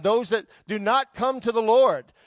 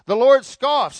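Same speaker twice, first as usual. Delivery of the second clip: ordinary delivery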